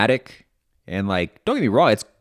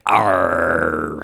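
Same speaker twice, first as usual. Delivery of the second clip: pirate voice; 4 semitones lower than usual. Retold or imitated. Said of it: "he talks like a pirate".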